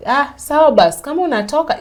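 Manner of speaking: husband waving her off, loud and dismissive. Retold or imitated. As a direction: toss it off like you don't care. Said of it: "that's what he told me".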